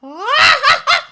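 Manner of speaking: high whooping monkey howl; howler monkey call. Pretend. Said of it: "your best howler monkey impression".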